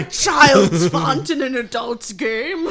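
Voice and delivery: loud, drawn-out indignant shout; hammed-up scornful holler; mock outrage. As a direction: bellow it slowly in playful protest.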